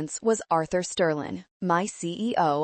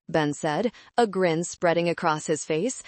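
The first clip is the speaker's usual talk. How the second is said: ordinary delivery, nothing marked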